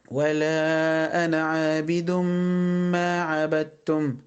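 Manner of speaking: slow recitation with a long hummed m in عابد ما; idgham with ghunna. Shown as a demonstration. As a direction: hold the merged m of عابد ما. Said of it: "مدها بمقدار حركتين". (عابد ما بإدغام وغنّة طويلة)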